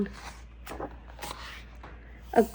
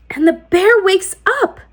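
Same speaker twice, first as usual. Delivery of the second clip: excited reveal; 7 semitones higher than usual. A narrator's reading. Climax narration.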